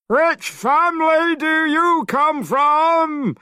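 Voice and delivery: very high, loud, slow demon voice; monster king. A narrator's reading. Great king demanding the boy's family, high and loud. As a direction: boom out the question like a monster.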